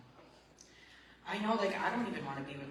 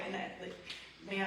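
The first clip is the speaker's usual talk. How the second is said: ordinary delivery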